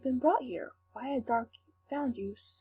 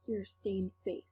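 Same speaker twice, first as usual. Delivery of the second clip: usual speaking voice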